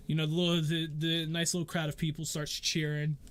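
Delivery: ordinary talk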